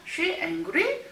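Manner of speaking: slow, with angry rising at the end; rising question tune. Shown as a demonstration. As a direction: raise angry to make it a question. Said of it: "끝을 올리는 것을".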